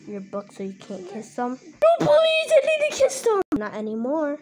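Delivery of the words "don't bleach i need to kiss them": high crying plea; desperate pleading cry. Acted. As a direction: beg desperately, near tears. Begging to save his books.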